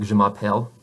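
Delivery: "Je m'appelle" with a dark English "L"; mock wrong "Je m'appelle", American "l"; English-accented French. Shown as a demonstration. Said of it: "you don't wanna sound like that".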